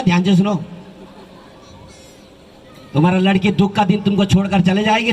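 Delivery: normal talking voice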